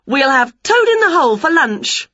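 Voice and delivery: high, loud gloating announcement; excited witch, high and loud; triumphant witch. A narrator's reading. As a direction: crow over the catch. Winnie gloats over caught toad.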